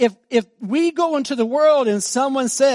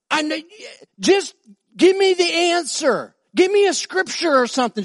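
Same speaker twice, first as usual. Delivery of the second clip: pleading; 8 semitones higher than usual. Retold or imitated. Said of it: "She goes".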